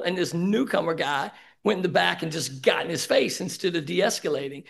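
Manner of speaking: ordinary talk, nothing marked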